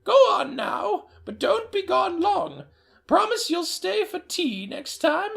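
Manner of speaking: high quavering grandmother voice; a doting old nana greeting her boy; delighted old woman greeting her grandson, high-pitched. Acted. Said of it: "Emma Joe said".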